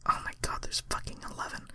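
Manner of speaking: fast whispered despair; real exasperated whisper. Real, not acted. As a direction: whisper it in disbelief. Reader despairs at the list.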